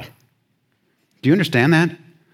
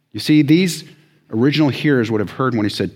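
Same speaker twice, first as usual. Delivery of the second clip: ordinary talk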